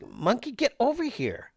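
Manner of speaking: high, sing-song pet call. Real, not acted. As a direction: coax the cat over, high and bright. Calling the cat over.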